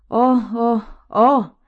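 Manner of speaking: high, loud wails; tearful exclamation. A narrator's reading. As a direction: cry out as tears break. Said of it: "exclamó la señora Weasley y rompió a llorar".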